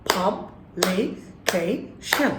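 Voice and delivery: publication very slowly in four beats: pub, li, kay, shun; syllable by syllable. Shown as a demonstration. Teacher counts the syllables with claps, syllable by syllable. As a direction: clap out publication with gaps between syllables. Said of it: "club দিয়ে দেখি তো কয়টা syllable".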